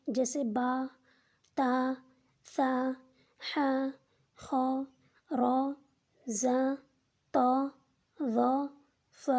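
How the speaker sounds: each letter name drawn out, one per breath; two-letter reading. Shown as a demonstration. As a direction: read the letters slowly with pauses. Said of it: "دو حرفی سے مراد". (ہر حرف الگ الگ، آہستہ کھینچ کر)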